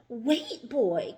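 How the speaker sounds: high, loud alarmed cry; cried out. A narrator's reading. Rock crying out to the boy in alarm. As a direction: cry out to stop him. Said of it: "cried Rock".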